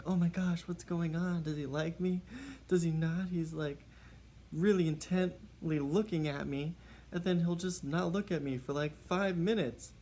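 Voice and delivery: imitating the girl. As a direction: play the smitten girl overthinking him. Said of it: "And she's just like".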